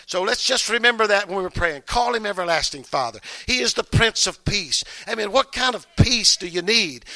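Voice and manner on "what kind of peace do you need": normal talking voice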